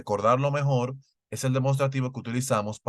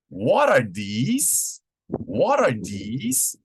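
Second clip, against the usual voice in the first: "What are these?" said very slowly with a rising tune; very slow model question; 3 dB louder, much slower than usual. Shown as a demonstration. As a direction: stretch the question out for learners. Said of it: "ejemplos en plural".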